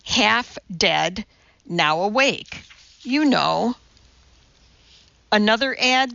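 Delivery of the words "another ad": ordinary delivery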